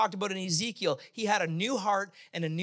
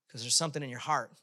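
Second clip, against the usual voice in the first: ordinary delivery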